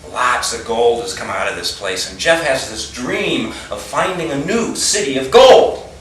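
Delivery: ordinary delivery, nothing marked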